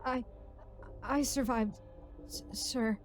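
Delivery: faltering, halting survivor's voice; shell-shocked. A narrator's reading. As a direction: stammer it out in broken pieces, lightly dazed. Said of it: "said in a shell-shocked voice".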